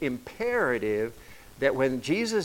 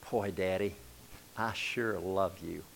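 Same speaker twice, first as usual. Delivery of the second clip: softer, slower, a hint of a small boy; child's voice; 5 semitones lower, 8 dB quieter than usual. Little son telling his frustrated dad he loves him, soft and sweet. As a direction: lightly voice a little son's sweet tribute. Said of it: "Tommy said".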